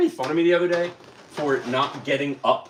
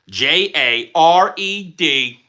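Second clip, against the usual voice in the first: spelling out name; 4 dB louder, much slower than usual. Shown as a demonstration. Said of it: "That's how it's spelled".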